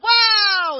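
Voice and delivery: very loud, high gasp of wonder; amazed wow. Retold or imitated. The American tourist marvels at the police boat, shouting loud. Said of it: "I'm like".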